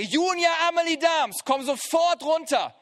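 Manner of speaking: sharp, loud call with every name; stern parent call. Shown as a demonstration. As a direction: call them down, they're in trouble. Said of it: "dann wissen sie, irgendetwas stimmt hier nicht".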